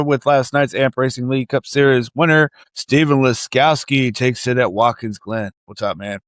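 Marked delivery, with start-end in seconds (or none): none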